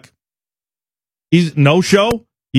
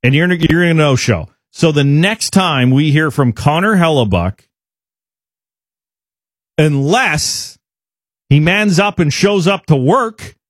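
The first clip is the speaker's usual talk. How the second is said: normal talking voice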